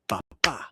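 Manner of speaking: "Ba" light, then "ba" stressed; iamb beat. Shown as a demonstration. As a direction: tap out the iamb with a stronger second "ba". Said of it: "an unstressed syllable followed by a stressed syllable".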